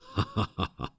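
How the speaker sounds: a short, delighted demonic laugh; amused chuckle. Acted. Demon chuckles at the mortal's shocked reaction, amused laugh. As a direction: laugh at their shock, pleased.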